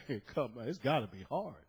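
preacher reaching out to Jehovah's Witnesses; gentle coaxing. Pretend. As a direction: coax them kindly, almost hushed. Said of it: "go looking for them and say".

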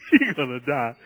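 low, with a laugh breaking in; amused aside. Real, not acted. Preacher repeats the men's fear to congregation, chuckling. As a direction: chuckle through the grim conclusion.

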